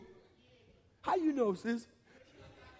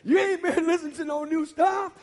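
higher and louder teasing call-out; playful shout; 7 semitones higher, 4 dB louder than usual. Real, not acted. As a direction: call them out with a laugh in it. Teasing the congregation.

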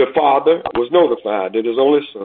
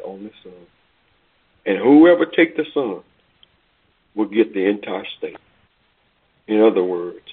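earnest preaching; 5 semitones lower than usual. Acted. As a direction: bring it home gently to the congregation. Sermon application.

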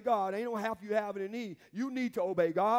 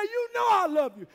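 [name] answering; 10 semitones higher, 8 dB louder than usual. Acted. [name]'s answer voiced loud.

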